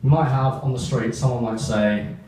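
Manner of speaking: ordinary talk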